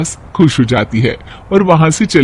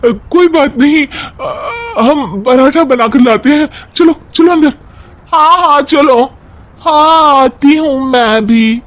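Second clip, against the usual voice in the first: much higher, bustling cheerful voice; eager bahus; 10 semitones higher than usual. Acted. Bahus rushing off to make parathas, high eager voice. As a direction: hurry everyone along.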